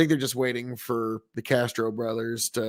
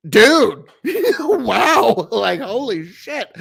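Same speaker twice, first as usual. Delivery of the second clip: amazed; 9 semitones higher, 8 dB louder, much slower than usual. Real, not acted. Amazed by a listener's story.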